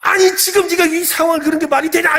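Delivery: angry parent. Retold or imitated. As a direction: demand how this could make sense, shrill with disbelief. Parent scolds child. (어이없어하며 높고 크게 따지는 부모)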